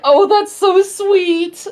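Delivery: high and slow delighted coo. Retold or imitated. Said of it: "he's like".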